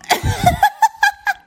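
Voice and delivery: high squealing burst of laughter; loud high laugh; shrieking laugh. Real, not acted.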